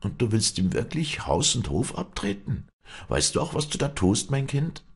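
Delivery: lightly voiced anxious clergyman; concerned priest. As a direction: gently challenge her decision. Priest questions her decision.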